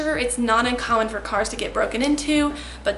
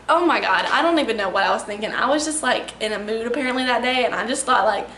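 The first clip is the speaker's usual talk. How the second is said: usual speaking voice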